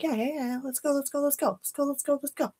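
mock self-hurrying, light and repetitive; mile-a-minute hurry. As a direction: rattle it off like you're rushing yourself. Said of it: "going a mile a minute".

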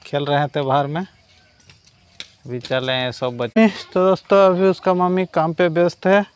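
ordinary talk

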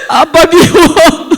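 slow, high, loud cry of reproach; tearful protest. Real, not acted. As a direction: let the hurt out slowly. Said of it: "한 번 더".

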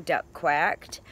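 plain reading voice

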